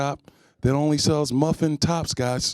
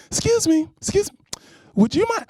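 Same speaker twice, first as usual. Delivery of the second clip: high polite mock-girly voice, much higher; mock sweet woman asking; 13 semitones higher than usual. Woman politely asking him for a photo, high sweet voice. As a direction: sweetly ask a favour in a high voice. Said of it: "You're like".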